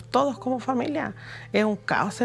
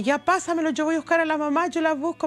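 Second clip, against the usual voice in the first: higher, take-charge quote; quick reassurance, re-enacted with raised pitch; urgent retold reply; 6 semitones higher than usual. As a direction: act out stepping in to help. Retelling her own urgent reply.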